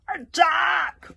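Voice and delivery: loud, commanding battle cry; Valhallarama. Acted. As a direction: roar the order magnificently. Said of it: "Valhallarama's deep as well commanding voice".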